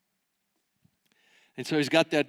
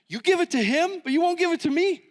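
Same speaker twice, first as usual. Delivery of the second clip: indignant complaint; 9 semitones higher, 3 dB louder than usual. Retold or imitated. Angry complaint at God.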